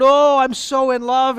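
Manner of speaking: mock gushy "I'm so in love"; gushy lovestruck. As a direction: ham up a lovesick teen. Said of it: "they're just so gushy".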